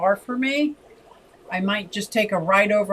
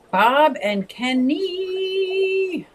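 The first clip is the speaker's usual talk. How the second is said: drawn-out greeting; 10 semitones higher, much slower than usual. Real, not acted. Stretched high greeting.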